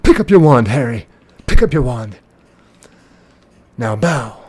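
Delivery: loud, commanding villain impression; Lord Voldemort. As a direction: order a duel like a theatrical dark lord. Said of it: "he's acting like Lord Voldemort".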